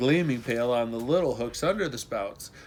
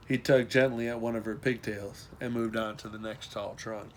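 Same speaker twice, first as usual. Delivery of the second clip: ordinary delivery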